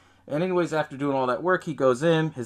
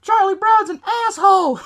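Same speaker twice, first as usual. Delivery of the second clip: high, loud bratty yell; imitated little-boy shout; kid shouting out; 9 dB louder than usual. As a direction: scream it like a defiant child. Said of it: "This kid shouts out".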